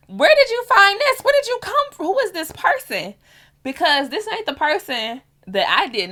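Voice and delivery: who is this person, shouted up high; incredulous outburst. Real, not acted. Reacting to her husband hanging up, loud disbelief. Shocked at husband's harsh reply.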